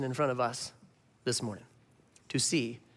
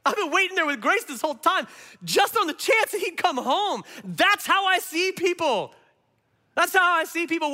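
higher and louder, rising to a peak; impassioned preaching; 10 semitones higher, 4 dB louder than usual. Acted. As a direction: preach it with full conviction. Preacher voicing Jesus, building.

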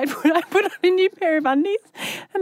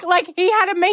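usual speaking voice

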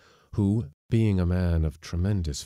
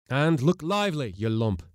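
higher-pitched impatient chivvying; gruff skipper's order; 8 semitones higher than usual. A narrator's reading. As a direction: chivvy a lazy hand along. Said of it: "continued the skipper".

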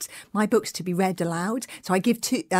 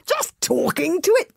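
astonished gasp; 9 semitones higher than usual. Acted. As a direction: gasp it in disbelief. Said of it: "gasped Fishlegs in astonishment".

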